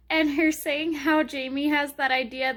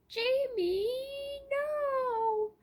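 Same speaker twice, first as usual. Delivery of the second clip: teary; 11 semitones higher, 6 dB quieter, much slower than usual. Real, not acted. Heartbroken for character.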